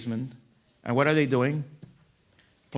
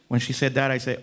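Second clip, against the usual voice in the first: ordinary talk, nothing marked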